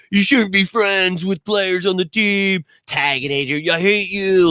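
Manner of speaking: high, slow mock whine; nasal-free mock whining complaint; whiny fan mockery. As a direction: parody the whiny fans. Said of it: "whiny douchebags that are gonna call".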